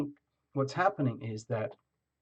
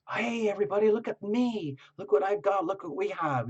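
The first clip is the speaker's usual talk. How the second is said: higher, bright, bragging call; showing off; 7 semitones higher than usual. Retold or imitated. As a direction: announce it to everyone proudly. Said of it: "like, saying".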